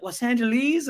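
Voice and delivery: "Los Angeles?" tossed up high as a question; the mates repeat "Los Angeles?" back in surprise; surprised echo. Retold or imitated. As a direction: echo "Los Angeles?" with a big rising lilt. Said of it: "they'd be like".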